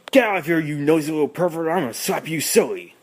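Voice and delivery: imitating an irate relative on a recording, rapid-fire; Uncle Frank. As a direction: rattle off a hammed-up threat. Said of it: "playing a recording of Uncle Frank".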